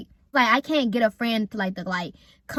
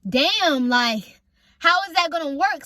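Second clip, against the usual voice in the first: exasperated retelling, pitched up a little; mad retort; 6 semitones higher, 5 dB louder, slower than usual. Retold or imitated. Teen retelling the reaction to mom's new condition, mad. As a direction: relive being mad, voice lifted. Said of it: "I'm mad. I'm like".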